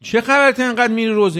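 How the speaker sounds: a touch of teasing disbelief; mocking friend. Friend repeating the jab at the young man, mock scoff. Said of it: "به جوونه میگه که رفیقاشو".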